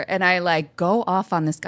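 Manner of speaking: ordinary talk, nothing marked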